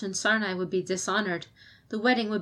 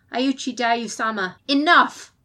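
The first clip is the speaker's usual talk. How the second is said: ringing shout of enough, high and loud; loud commanding shout; 5 semitones higher, 9 dB louder, slower than usual. A narrator's reading. Champion silencing an argument in council with a ringing shout. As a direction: cut them off like a clarion call. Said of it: "Her voice was as loud as a clarion call".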